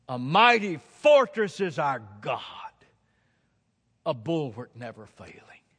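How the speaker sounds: loud, ringing recitation of a hymn; declaimed hymn line. Retold or imitated. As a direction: proclaim the hymn line boldly.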